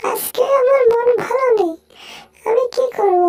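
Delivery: high, excited cartoon mouse, squealing and fussing; squeaky mouse voice. Mouse finding food then scolding the sleepy cat, high squeaky voice. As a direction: play a tiny panicky mouse, high up.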